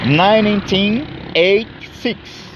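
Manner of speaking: high, slow and drawn out, each syllable clear; slow model pronunciation. Shown as a demonstration. Teacher showing students how to say the date, slow and high. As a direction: model the date slowly for learners. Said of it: "como se pronuncia?".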